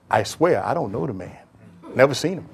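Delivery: low, soft nervous denial; hushed panicky denial; Peter denying. Acted. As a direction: deny it quietly as scared Peter. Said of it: "Peter denying accused".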